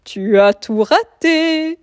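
slow, loud teasing wolf; mocking old wolf. A narrator's reading. Wolf teases cub.

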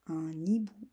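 un hibou whispered, no voice; whispered repeat. Shown as a demonstration. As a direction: whisper un hibou like a secret dictation. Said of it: "répétition chuchotée du mot". (un hibou chuchoté)

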